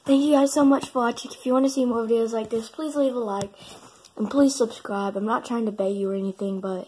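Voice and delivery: loud fast vlog sign-off; youtuber outro. Acted. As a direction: pitch the subscribe hard.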